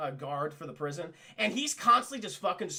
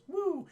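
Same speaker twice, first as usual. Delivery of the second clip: high playful woo; mock whoosh; 11 semitones higher than usual. Hero showing the apes flight with a paper plane, high playful woo. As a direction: make the plane glide off.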